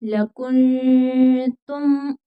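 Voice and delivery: लकुंतुम slow, hidden nasal n; tajweed demo. Shown as a demonstration. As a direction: chant लकुंतुम with ikhfa. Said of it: "यहाँ पर इख्फा होगा".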